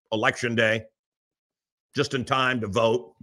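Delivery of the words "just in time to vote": normal talking voice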